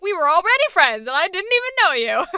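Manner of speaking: high, loud gushing reply; bubbly excited. Retold or imitated. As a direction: gush with delight. Voicing Aster's reply.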